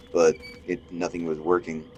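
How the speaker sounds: ordinary delivery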